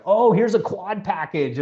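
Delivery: high, slow, gushing sales pitch; overexcited pitch. Retold or imitated. Eager seller jumping on a prospect, overexcited. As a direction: oversell it with breathless glee. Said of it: "without getting so excited".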